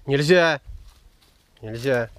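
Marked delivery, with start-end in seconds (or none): 0.1-0.6 sharp loud "no" to the dog; firm, loud forbidding; stern command. Real, not acted. Строгая команда собаке. (строгий громкий запрет)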